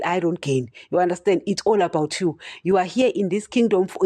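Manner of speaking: ordinary delivery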